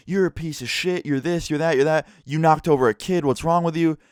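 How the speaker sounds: high-pitched mock tirade; imitated angry rant. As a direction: imitate a drunk dad laying into a teen. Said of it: "yelling and screaming at people".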